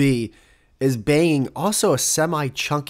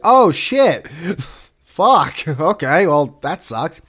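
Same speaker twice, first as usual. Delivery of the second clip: louder, much higher shocked gasp of a swear; shocked realization; 4 semitones higher, 6 dB louder, much slower than usual. Retold or imitated. Reacting to finding out the rider died, loud shocked. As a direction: react as if the news just hit. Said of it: "it's like".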